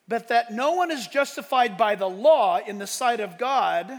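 loud, ringing scripture read; preaching scripture reading. Acted. Pastor reading Galatians aloud to the church, proclaiming. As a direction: proclaim the verse to the congregation.

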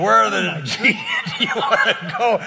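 imitated cussing driver. Cab driver asking passengers where to go, mock cussing. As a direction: play the cursing cabbie, breaking into laughter. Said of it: "he's cussing".

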